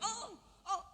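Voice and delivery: high, soft mock yelp; imitated cry of pain. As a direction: act out the brother-in-law crying out, kept small. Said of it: "ipar saya berteriak".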